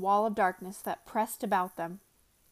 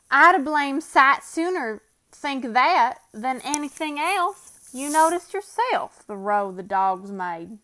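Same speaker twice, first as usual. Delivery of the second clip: Bill's voice; 4 semitones higher, 7 dB louder, slower than usual. Acted. Bill agrees uneasily.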